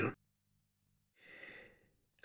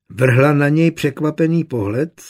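ordinary delivery, nothing marked

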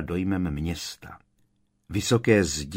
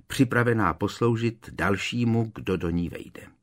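usual speaking voice

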